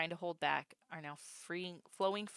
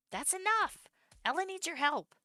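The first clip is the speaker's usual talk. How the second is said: much higher and louder, a yelled order; shouted command; 13 semitones higher, 7 dB louder than usual. Acted. Hugo shouting at Soul to stop and help Ella. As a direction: yell to snap him out of it.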